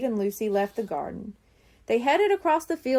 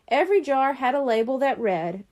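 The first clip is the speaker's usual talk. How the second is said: plain reading voice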